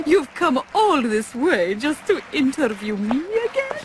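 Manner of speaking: normal talking voice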